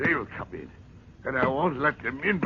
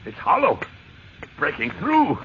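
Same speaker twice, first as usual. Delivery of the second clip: rising breathless excitement; thrilled at the discovery; ecstatic; 5 semitones higher, 5 dB louder than usual. Acted.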